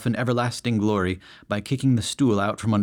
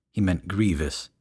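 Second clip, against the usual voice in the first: ordinary delivery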